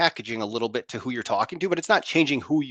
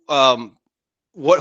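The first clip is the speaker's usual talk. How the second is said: ordinary talk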